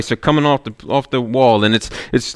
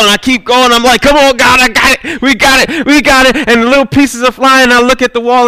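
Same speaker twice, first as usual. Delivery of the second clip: preaching at full force, much higher and louder; 11 semitones higher, 5 dB louder than usual. Real, not acted. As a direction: drive the sermon to its peak, climbing hard. Preacher builds to climax.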